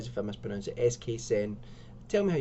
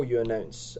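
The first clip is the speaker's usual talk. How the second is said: usual speaking voice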